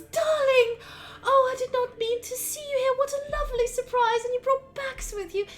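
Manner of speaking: high, delighted gushing mother; brightened-up doting mother. Acted. Mother surprised by her son's visit, brightening up. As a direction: gush in a high, lilting mother's voice. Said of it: "immediately brightens up".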